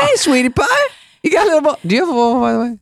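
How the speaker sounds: mocking high taunting voice. Teasing bit continues.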